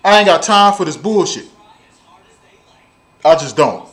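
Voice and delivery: ordinary talk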